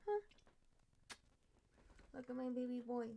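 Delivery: ordinary talk, nothing marked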